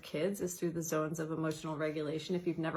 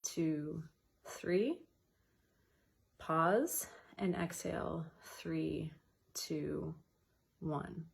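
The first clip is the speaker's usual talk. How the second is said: softer and softer counting, pause held; counted breathing; 3 dB quieter, much slower than usual. Shown as a demonstration. Guiding listeners through timed breathing, counting softly down. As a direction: guide the breath count gently, fading. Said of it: "it would sound or look like this".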